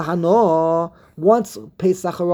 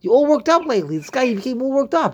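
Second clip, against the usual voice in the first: higher-pitched playful ribbing; mock teasing; 6 semitones higher, faster than usual. Teacher ribbing his study partner for being worked up, higher mock teasing. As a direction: tease him about being all worked up.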